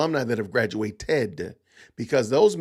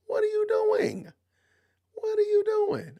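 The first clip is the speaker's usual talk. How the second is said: much higher, slow, drawn-out question; mock outrage; slower than usual. Real, not acted. Streamer teasing Phil, high slow mock outrage. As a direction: squeak it out in fake shock.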